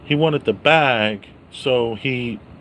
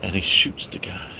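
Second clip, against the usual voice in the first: whisper; 5 semitones lower, 5 dB quieter than usual. Real, not acted. Hushed retelling.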